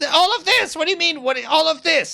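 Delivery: high, loud and indignant, easing as it goes; exasperated villain outburst. Acted. Cornered wizard protesting "all of this" to his attackers, exasperated.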